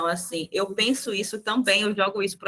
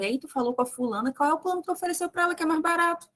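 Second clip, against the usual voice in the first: nosy coworker; 9 semitones higher, faster than usual. Retold or imitated. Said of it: "a outra".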